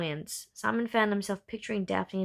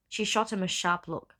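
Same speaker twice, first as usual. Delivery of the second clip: ordinary delivery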